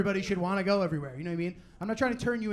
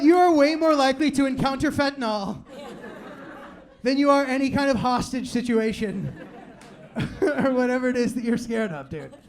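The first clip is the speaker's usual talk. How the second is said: loud, laughing disbelief at a hypocrite; laughing retort; 4 semitones higher, 6 dB louder than usual. Retold or imitated. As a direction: call them out mid-laugh. Mocking friends doing cocaine.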